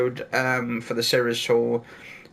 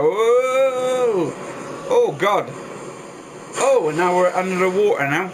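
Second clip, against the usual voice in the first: on a roller coaster; 4 semitones higher than usual. Real, not acted. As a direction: gasp out on the plunge. Big coaster plunge into water.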